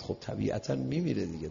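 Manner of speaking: ordinary delivery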